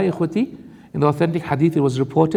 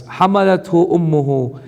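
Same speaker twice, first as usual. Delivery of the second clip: fast, chanted Arabic recitation; تلاوة القرآن; Quran recitation; 2 semitones higher, much faster than usual. Acted. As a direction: recite the verse in tajweed. Recites verse.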